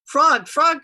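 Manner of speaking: high, loud calling. A narrator's reading. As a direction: call out excitedly to a friend. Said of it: "cried Toad".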